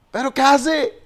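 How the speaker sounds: high-pitched incredulous mock shout; retold yell from behind; imitated shouting. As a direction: call out as a disbelieving disciple. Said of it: "los discípulos le gritaban".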